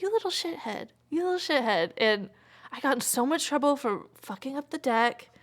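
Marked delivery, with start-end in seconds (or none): 0.0-1.9 high, fond mock scolding; affectionate scolding. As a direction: scold the dog lovingly, pitched up. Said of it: "and then just be like".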